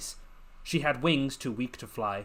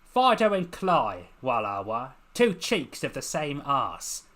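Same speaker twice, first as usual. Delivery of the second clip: boisterous; 5 dB louder than usual. Acted. Lucy boisterously mocking the two urchins opposite. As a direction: throw it out boisterously. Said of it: "She spoke boisterously".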